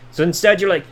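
usual speaking voice

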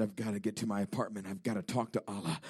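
low, soft retold quote; quiet resolve. The man resolving to talk to Allah, quiet.

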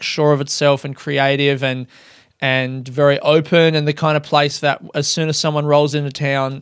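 ordinary delivery

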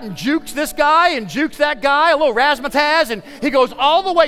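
high-pitched, breathless-paced play-by-play build; excited sports storytelling. A narrator's reading. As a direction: call the big play with rising thrill. Excited telling of the winning play.

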